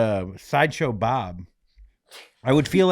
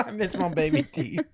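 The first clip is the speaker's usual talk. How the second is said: laughing; 5 semitones higher, 4 dB quieter than usual. Retold or imitated. Echoing her line, laughing.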